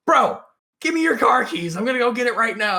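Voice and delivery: high, urgent mock impatience; retold exasperation. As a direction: imitate a fed-up friend taking over. Annoyed friend taking over, acted.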